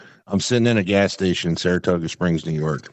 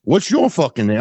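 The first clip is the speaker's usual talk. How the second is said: tough caller; 6 semitones higher, 3 dB louder than usual. Retold or imitated. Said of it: "And he's like".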